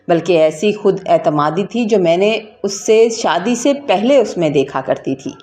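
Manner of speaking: plain reading voice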